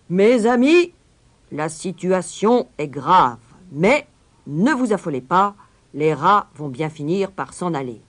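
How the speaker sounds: loud, high, pompous reassurance; mayor calming the crowd. Acted. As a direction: soothe the angry crowd from the steps. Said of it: "pour essayer de les calmer". (maire qui calme la foule d'une voix forte)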